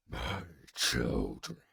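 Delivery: a vast dragon grieving, drawn out; dragon howl. Acted. Deathwing mourns his slain brood, low and slow. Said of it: "the dragon howled".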